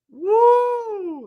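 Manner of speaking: high, delighted whoop; a real burst of excitement; excited whoop. Real, not acted. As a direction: let out a thrilled woo. Reacting to spicy ginger soup.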